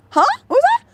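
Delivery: very high, loud disbelieving squeak; confused reaction. Retold or imitated. Exchange students reacting to the shop name, squeaky surprise. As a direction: squeak it out in mock bewilderment.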